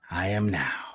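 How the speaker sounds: breathy whispered affirmation; soft whispered I am now. Shown as a demonstration. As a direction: hush it down to a whisper.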